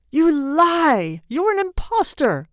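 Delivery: loud, high outraged shout; furious accusation. A narrator's reading. Frantic father to the old man, shouting accusingly. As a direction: cry out the accusation.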